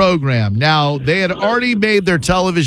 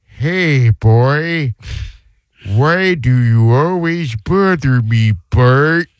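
slow, odd cartoon dad voice; early Homer Simpson; much slower than usual. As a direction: do a drawn-out, crude cartoon-character impression. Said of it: "the Homer voice used to be like".

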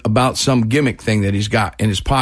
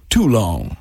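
[name]; 2 semitones lower than usual. Retold or imitated. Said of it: "that's the way he talks".

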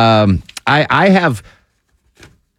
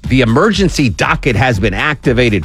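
higher, upbeat radio hand-off into a break; radio host tease; 5 semitones higher than usual. Real, not acted. Host handing to news, weather and traffic, in radio tease delivery.

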